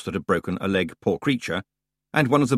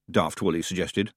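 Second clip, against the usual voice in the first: ordinary talk, nothing marked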